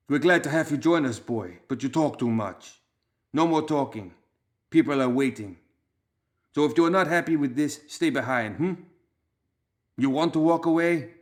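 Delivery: a hint of a tough Balkan boss; hard gang leader Fish, lightly voiced. A narrator's reading. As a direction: leader laying down terms. Fish cuts off Loomis.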